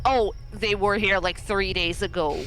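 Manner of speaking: ordinary delivery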